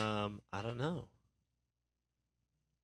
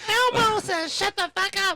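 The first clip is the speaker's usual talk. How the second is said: very high, loud puppet falsetto; Elmo; 8 dB louder than usual. Acted. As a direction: squeal it out like a furry puppet. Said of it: "Now we're gonna do Elmo".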